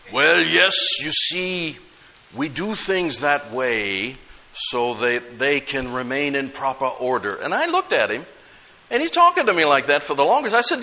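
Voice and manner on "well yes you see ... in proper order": slow, drawn-out, softly spoken minister; professional tone. Retold or imitated. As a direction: imitate a minister's measured professional voice. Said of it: "spoke in such a professional tone".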